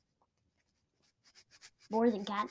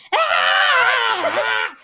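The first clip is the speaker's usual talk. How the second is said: sudden high shout of alarm; loud mock scream; 8 semitones higher, 12 dB louder than usual. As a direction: belt out a startled scream. Retelling the opening scream.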